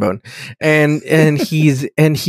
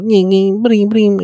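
high mock alien chatter; high-pitched alien noises; 8 semitones higher, slower than usual. Binaries touching things on the bridge, high-pitched mock chirping. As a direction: imitate squeaky machine talk, up high. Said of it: "what's that high-pitched noise you're making".

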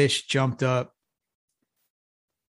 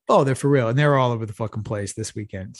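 usual speaking voice

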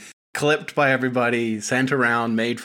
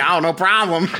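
loud mock voice; 4 semitones higher, 3 dB louder than usual. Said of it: "He's like".

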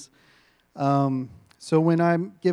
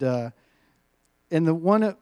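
normal talking voice

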